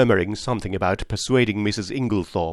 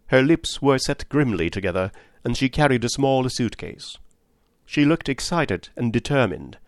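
plain reading voice

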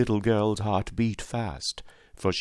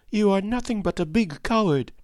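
put-on very high, accusing girl's voice; indignant retort; 12 semitones higher than usual. A narrator's reading. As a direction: fling the accusation in a child's high voice. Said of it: "she retorted".

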